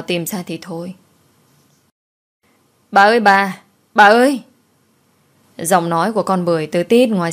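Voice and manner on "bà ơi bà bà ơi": gọi to từ xa. Acted. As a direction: call out to the mistress from far away. Bưởi gọi từ ngoài sân. (gọi to thất thanh từ ngoài sân)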